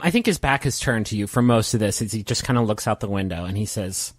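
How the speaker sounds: ordinary delivery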